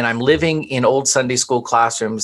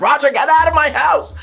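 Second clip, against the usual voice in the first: much higher, louder shouted order; retold scream; 3 dB louder than usual. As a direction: yell him out of the house, losing it. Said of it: "And I, I screamed".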